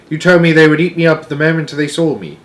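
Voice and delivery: lightly voiced child arguing back; protesting Sophie. Sophie protests she is stuck in the cave, indignant.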